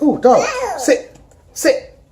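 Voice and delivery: high, slow mock order to sit; high cooing command to a dog. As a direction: imitate a stranger bossing the dog.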